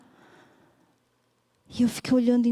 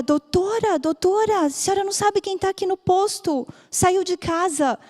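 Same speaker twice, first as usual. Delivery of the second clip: much higher, urgent and eager; excited health worker; 8 semitones higher than usual. Retold or imitated. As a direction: rush in with big news. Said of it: "veio a agente comunitária". (agente comunitária chamando a doutora, animada)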